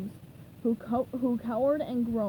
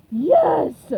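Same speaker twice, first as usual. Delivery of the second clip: very loud victory shout; triumphant cry; 4 semitones higher, 13 dB louder than usual. A narrator's reading. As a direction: crow over your win. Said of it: "cried Plankton triumphantly".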